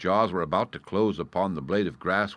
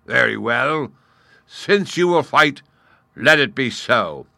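loud, raised, deliberate declaration; roaring; 5 semitones higher, 9 dB louder than usual. Acted. Lion agreeing to fight the gnat, roaring. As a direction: accept the challenge at a roar. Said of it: "roared the lion".

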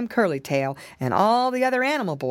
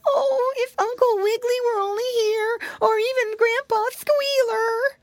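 wailing piglet. Acted. Piglet crying for help.

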